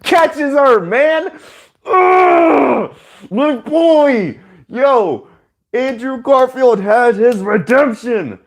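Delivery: excited cheering. Real, not acted. Reviewer cheering Andrew Garfield's redemption, ecstatic high cry. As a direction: cry out with pure fan joy.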